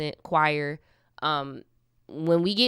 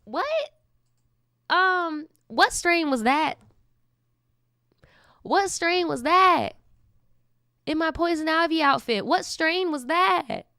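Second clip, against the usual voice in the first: shocked disbelief; 9 semitones higher than usual. Real, not acted. Mock outrage at the story.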